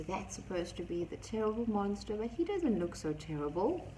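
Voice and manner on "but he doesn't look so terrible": usual speaking voice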